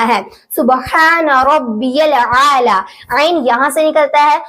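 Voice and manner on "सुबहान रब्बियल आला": slow, letter by letter, throaty ain in "आला"; letters from makhraj. Shown as a demonstration. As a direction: draw out "सुबहान", then squeeze "आला" from the throat. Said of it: "मखरज की अदायगी के साथ". (धीरे, एक-एक हर्फ़, "आला" में "ऐन")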